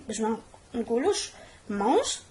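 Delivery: ordinary delivery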